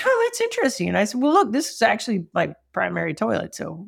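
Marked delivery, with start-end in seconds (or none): 0.0-0.9 high surprised remark; pitched-up mild surprise; light mock wonder; intrigued. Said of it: "And I was like".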